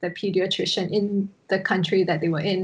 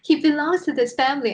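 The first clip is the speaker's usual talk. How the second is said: higher, emphatic imitation of a protective mom; devoted adoptive mother; 7 semitones higher, 4 dB louder, faster than usual. Mother claiming the boy as family, emphatic imitation. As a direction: declare it as the insistent mother. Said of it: "he's like".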